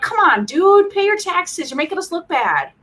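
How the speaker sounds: high-pitched pleading reproach, easing down; exasperated imitation of a let-down crowd; mock group exasperation. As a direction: scold a friend who let everyone down. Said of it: "we were all like".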